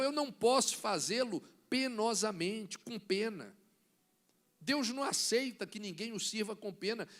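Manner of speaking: usual speaking voice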